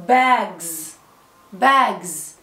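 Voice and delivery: "باجز" slowly, ending in a voiced buzzing z; final z sound. Shown as a demonstration. Teacher asking s or z, "باجز" slow with a z ending. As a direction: model "باجز" as bags, with z in place of s. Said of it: "فاينل اس بتتنطق زي".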